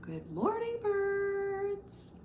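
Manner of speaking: teacher greeting birds in a playful high voice; changed tone. Shown as a demonstration. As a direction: lift the voice high and sweet for the birds. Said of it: "change your tone".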